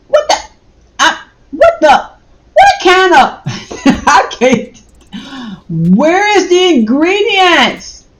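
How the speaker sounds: 높고 큰, 어이없다는 외침; exasperated yelling. Real, not acted. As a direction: shriek in disbelief at the label. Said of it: "I'm sorry, y'all, for yelling".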